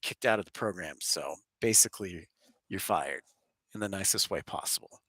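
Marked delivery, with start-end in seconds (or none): none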